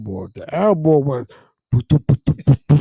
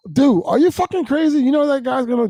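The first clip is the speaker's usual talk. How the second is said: mock alarm; 12 semitones higher than usual. Business agent shocked.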